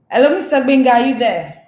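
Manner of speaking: calling out. Real, not acted. Host calling to absent guest.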